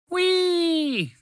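very high joyful squeal; gleeful whee. Acted. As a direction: squeal it like a kid on a slide. Creatures hopping shape to shape.

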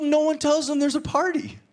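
ordinary talk, nothing marked

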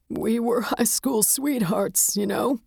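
put-on high, slow, mournful old widower; grieving old man. A narrator's reading. Widower tells the detective about young love, mournfully. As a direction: reminisce in a high, grief-worn voice.

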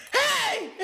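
loud, very high yelp; cracking yell. Acted. Retelling yelling at somebody, voice cracking high. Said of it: "yelling at somebody all serious".

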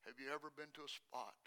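low, very soft appeal to the audience; hushed preaching. Acted. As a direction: ask the congregation quietly and sincerely.

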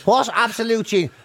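ordinary talk, nothing marked

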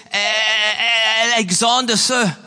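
put-on a stammering, frightened young soldier; petrified stammer. A narrator's reading. Cowering soldier answering Alexander the Great, petrified stammer. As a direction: stutter out the name as if terrified.

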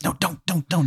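high, soft, hushed plea; urgent pleading. Acted. Face begging patient not to call, pleading.

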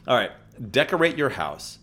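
ordinary talk

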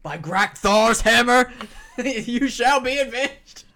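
slow, loud, high mock-solemn vow; laughing through a grand movie oath; hammed-up alien oath. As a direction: declaim it like a ham sci-fi actor. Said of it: "Galaxy Quest".

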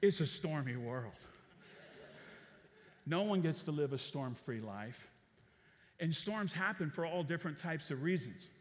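ordinary delivery

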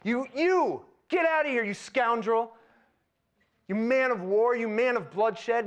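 high, loud furious yell at a fleeing king; retold yelling. Shimei cursing David as he leaves the city, loud furious yell. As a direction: hurl the words like the rocks he's throwing. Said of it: "He was yelling at him".

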